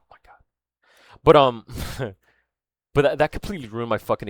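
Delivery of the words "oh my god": a soft exasperated whisper. Real, not acted. Vlogger embarrassed at losing his thread, whispering. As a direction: breathe it out in a whisper. Lost his train of thought.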